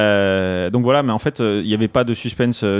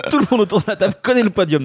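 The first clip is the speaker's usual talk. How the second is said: laughing; 9 semitones higher, faster than usual. Real, not acted. Laughing at his own recycled text.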